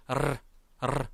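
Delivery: "르" as a tongue trill; rolled R. Shown as a demonstration. Teacher showing how it must sound, rolled R. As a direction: roll "르" twice, separately. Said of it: "이렇게 발음을 해주셔야".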